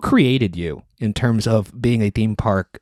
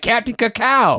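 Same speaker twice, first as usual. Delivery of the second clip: high-pitched imitation of a thrilled kid; excited child; 12 semitones higher, slower than usual. Imitating daughter greeted with her new bear, excited child. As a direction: squeal the name like a delighted little girl.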